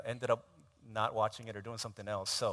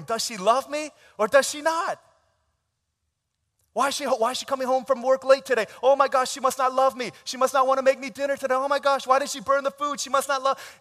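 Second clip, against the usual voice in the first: high, loud fretting panic; anxious husband; 11 semitones higher, 12 dB louder than usual. Retold or imitated. Husband fretting his wife doesn't love him, high and panicky. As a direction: spiral into worried panic.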